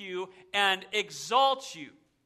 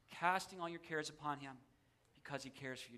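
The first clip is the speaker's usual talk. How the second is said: very soft, lowered scripture reading; gentle reassurance; 5 semitones lower, 14 dB quieter than usual. Real, not acted. Preacher letting the verse sink in, gentle reassurance. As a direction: let the verse settle quietly, dropping lower at the end.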